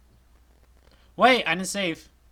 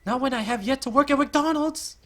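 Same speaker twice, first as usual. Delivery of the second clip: high, loud panicked wail; panicked shriek; 12 dB louder than usual. Acted. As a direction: cry out in comic panic. Panicked character outburst.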